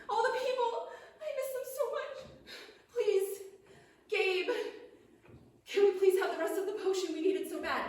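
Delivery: high, whiny plea with pauses; plaintive begging. Acted. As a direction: beg like a child missing family. Begging Gabe for the potion.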